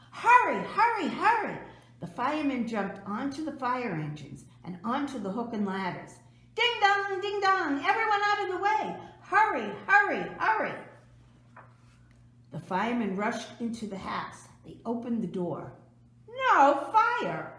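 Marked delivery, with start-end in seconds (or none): 0.1-1.6 high, loud urging shout; urgent call. A narrator's reading. Firemen urging each other on to the fire, shouting. 6.5-10.7 high, loud, fast clanging cry; siren call. A narrator's reading. Firemen racing through the streets, shouting for the way to clear. 16.3-17.5 surprised exclamation. A narrator's reading. Surprise at finding no fire.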